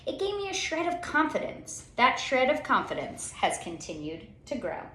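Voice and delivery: usual speaking voice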